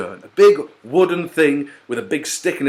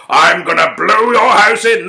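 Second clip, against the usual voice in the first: loud, higher wolf growl of a threat; menacing wolf threat; 7 semitones higher, 7 dB louder than usual. Acted. Wolf threatening to blow the pig's house in, loud. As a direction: bellow it at the brick house.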